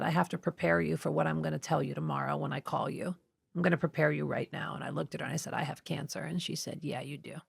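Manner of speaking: hushed. Retold or imitated. A doctor quietly breaking the cancer news, hushed. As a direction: say it barely above a breath.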